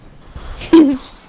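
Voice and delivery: loud, high burst of laughter; real high-pitched laugh; sudden laugh. Real, not acted. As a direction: burst out in one short laugh. Laughs after own joking apology.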